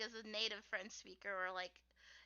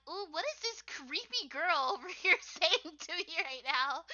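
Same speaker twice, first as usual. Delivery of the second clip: mock weirded-out reaction, higher and louder; drawn-out pretend bafflement, pitched up; imagined weirded-out listeners; 5 semitones higher, 5 dB louder, slower than usual. Imagined strangers reacting to a creepy girl, mock weirded-out. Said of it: "they'll probably be like".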